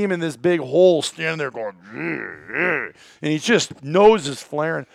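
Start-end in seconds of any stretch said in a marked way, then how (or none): 1.9-2.9 low guttural bull grunts; imitated bull grunt.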